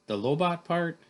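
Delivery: high, loud questioning exclamation; incredulous question. Acted. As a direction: blurt it back as a startled question. First take, before misery correction.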